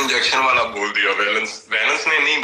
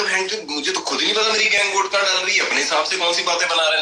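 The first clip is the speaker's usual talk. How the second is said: higher, exasperated retort, retold; heated retort; 7 semitones higher than usual. Telling off Harsh at the vote-out, exasperated and higher. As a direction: snap back in frustration, pitched up.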